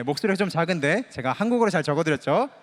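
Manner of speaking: ordinary talk, nothing marked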